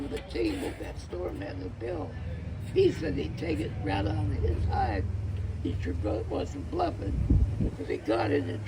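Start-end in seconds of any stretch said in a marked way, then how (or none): none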